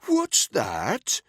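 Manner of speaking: mock-loud, high jeering shout; theatrically loud mocking question; exaggeratedly loud. A narrator's reading. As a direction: bellow it as a taunt. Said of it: "in an exaggeratedly loud voice".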